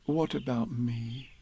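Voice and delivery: feeling left out; sadly. A narrator's reading. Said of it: "said Pooh sadly".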